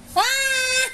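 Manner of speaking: sudden high startled "Ah!"; surprised yelp. Real, not acted. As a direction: jump up in pitch, startled. Dog grabs treat suddenly.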